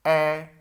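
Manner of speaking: ए called out loud and clear; stressing the vowel sound ए; loud vowel demo. Shown as a demonstration. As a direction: punch the vowel ए loudly for the class. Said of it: "ये ए की sound है".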